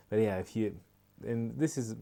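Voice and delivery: normal talking voice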